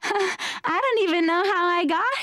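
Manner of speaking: woman answering the weightlifter guy, in a high ditzy voice; ditzy girl. Retold or imitated. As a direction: play the ditzy girl fishing for a gift. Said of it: "you're gonna go".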